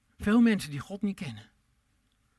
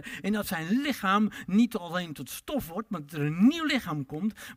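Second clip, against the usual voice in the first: ordinary talk, nothing marked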